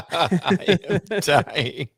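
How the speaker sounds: drawn-out words through real laughter; laughing. Real, not acted. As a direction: laugh your way through it slowly. Repeats caller line while laughing.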